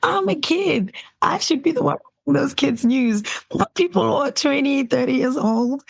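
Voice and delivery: mock outrage of a kid who wants the job; indignant kid. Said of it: "I was like".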